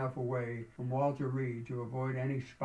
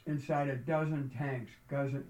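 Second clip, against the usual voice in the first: usual speaking voice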